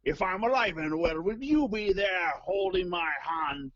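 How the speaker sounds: drawn-out, hammy mock-Scottish delivery; Sean Connery. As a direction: recite karaoke lyrics as a shitty Connery. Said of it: "really shitty Sean Connery impression".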